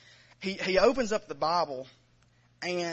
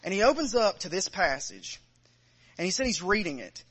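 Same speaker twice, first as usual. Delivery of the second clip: normal talking voice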